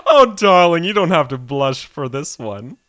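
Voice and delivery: loud opening, theatrical coo; teasing society lady. Acted. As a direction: tease a blushing friend grandly. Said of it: "you're so embarrassed".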